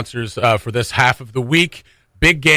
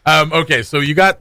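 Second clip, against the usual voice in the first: ordinary talk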